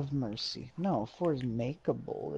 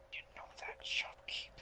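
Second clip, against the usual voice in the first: whispering; 12 dB quieter, faster than usual. Real, not acted. Whispered aside.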